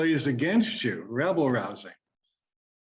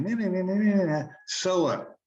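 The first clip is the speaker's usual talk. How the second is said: higher sing-song nyah-nyah, lightly mocking; a light childish jeer, pitched up; mocking taunt; 4 semitones higher than usual. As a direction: tease like a nagging inner voice. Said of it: "that little voice going".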